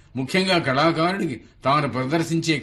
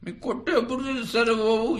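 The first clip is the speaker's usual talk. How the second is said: pestering caller; 10 semitones higher than usual. Acted. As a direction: whine it out in a high, stretched mimic voice. Caller's question in joke. (ఎత్తైన గొంతుతో సాగదీసిన బతిమాలే పిలుపు)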